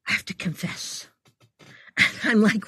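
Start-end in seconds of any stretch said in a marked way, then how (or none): 0.1-1.1 soft, breathy confession; guilty whisper. Retold or imitated. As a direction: whisper it like a shameful secret. Guilty friend confesses in library.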